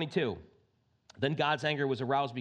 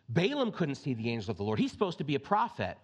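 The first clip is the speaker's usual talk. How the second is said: ordinary talk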